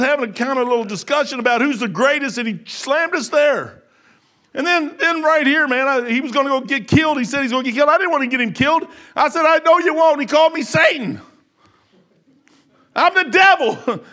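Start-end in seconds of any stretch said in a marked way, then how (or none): 4.5-14.0 high, loud preacher's cry; fervent revival preaching; preaching at full force. Acted.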